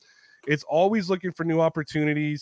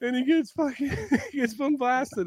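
laughing; 10 semitones higher than usual. Real, not acted. Telling how his co-host got fired, laughing. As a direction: laugh while saying it.

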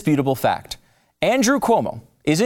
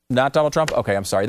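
ordinary talk

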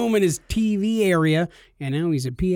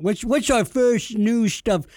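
slow, higher Connery-ish newscaster; a light hint of Sean Connery; Sean Connery impression; 6 semitones higher, slower than usual. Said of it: "going into Sean Connery".

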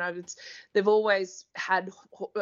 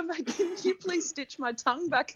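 higher, mock bitten-tongue plea; asking the doctor to stitch his tongue, higher mock plea; imitating a bitten tongue; 8 semitones higher than usual. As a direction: plead with the doctor as if your tongue is cut. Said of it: "he said to the doctor".

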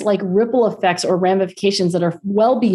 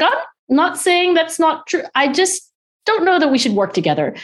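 imitating careful diplomacy, higher pitched; tactful backpedalling; 10 semitones higher than usual. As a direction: soften the blow while retelling it. Retold husband backpedalling gently.

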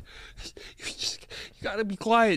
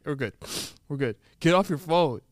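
ordinary talk, nothing marked

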